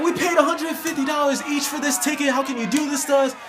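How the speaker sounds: put-on high, indignant drunk complaining; angry protest. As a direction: whine it out like an outraged fan. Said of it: "the people were so pissed off".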